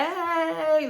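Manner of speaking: high, drawn-out excited cheer; Kimmy, pumped. Retold or imitated. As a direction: imitate a pumped-up woman's long high cheer. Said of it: "Like, she pumped".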